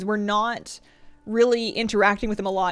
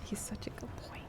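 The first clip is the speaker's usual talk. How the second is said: soft unvoiced whisper to the dog; 18 dB quieter than usual. Real, not acted. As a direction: breathe it tenderly to him.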